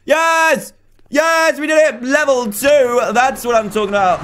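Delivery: high, loud victory shout; triumphant cheer. Real, not acted. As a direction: whoop through the win. Celebrates finishing level two.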